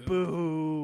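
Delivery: loud booing call; mock boo. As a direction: boo the heel, hammed up. Imitating crowd booing.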